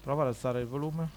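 quiet, distant phone voice; talking through speakerphone. Real, not acted. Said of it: "Eh, al massimo".

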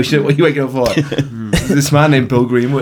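laughing mock of the partner's question; laughing mock quote. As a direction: tease through laughter.

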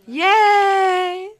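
high, loud yay; excited cheer. Real, not acted. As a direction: cheer happily. Cheering at bottle.

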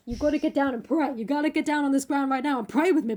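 high, loud mock-preacher urging; hammed-up insistence, quickening as it goes; exhorting Basil. Painter ordering Dorian down to pray, fervent.

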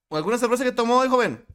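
high, loud officer questioning; police officer voice. Retold or imitated. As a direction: question the driver like a cop.

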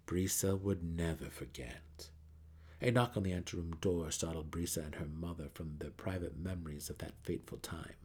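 ordinary delivery, nothing marked

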